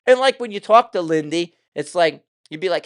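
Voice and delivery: usual speaking voice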